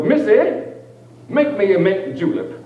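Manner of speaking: lightly voiced plantation master giving an order; a hint of a lordly master. Bit for a comedy crowd, mock master ordering his slave.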